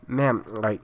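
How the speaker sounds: ordinary delivery, nothing marked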